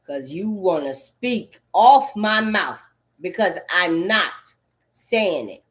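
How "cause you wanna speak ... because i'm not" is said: normal talking voice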